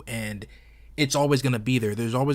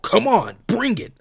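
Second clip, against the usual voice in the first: higher, louder hammed-up taunt; mocking quote; 10 semitones higher, 7 dB louder than usual. Outraged fan mocking Zod's new dialogue, hammed-up high taunt. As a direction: throw the line back in disbelief, loud and high. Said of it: "when has Zod said something like that".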